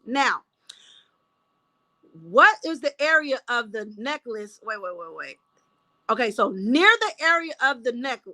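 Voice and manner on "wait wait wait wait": lower, much softer mutter, losing the thread; real quiet self-talk while scanning notes. Real, not acted. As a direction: say it half to yourself. Muttering to herself over notes.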